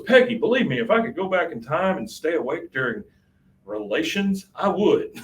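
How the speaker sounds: lightly voiced husband begging forgiveness; pleading Hank.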